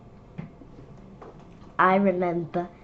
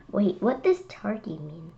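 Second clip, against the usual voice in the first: ordinary delivery, nothing marked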